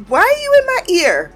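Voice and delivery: high, fast exasperated snap; irritated outburst. Retold or imitated. Summer snapping at someone in her ear, high exasperated burst. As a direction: snap at the person crowding you. Annoyed at person nearby.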